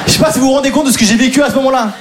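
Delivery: usual speaking voice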